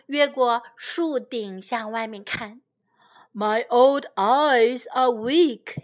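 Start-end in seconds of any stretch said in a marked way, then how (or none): 3.3-5.8 put-on slow, louder old man's lament; old king. Old king peering over the treetops, weary complaint. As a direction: creak it out like a tired old king. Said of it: "he said".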